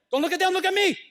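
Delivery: high, loud insistent call; urgent plea. Acted. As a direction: demand their eyes, high and loud. God urgently calling attention back.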